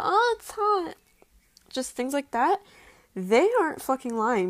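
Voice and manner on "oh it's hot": very high mock-animation voice; high-pitched hentai voice. Mimicking women in adult animation, high-pitched. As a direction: squeal it as an exaggerated cartoon. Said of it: "making high-pitched noises".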